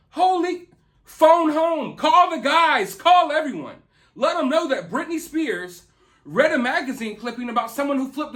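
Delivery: sarcastic call to spread news. Pretend. Sarcastic mock urgency.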